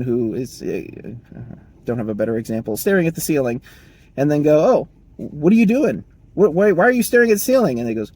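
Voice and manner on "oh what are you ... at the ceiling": higher, louder, quick scolding questions; suspicious boss. Retold or imitated. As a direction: demand what he is doing like a nosy manager. Manager catches worker staring.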